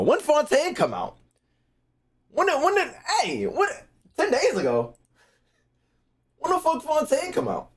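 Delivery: high, incredulous questions; wait, that can't be right; shocked disbelief. Real, not acted. Shocked at date.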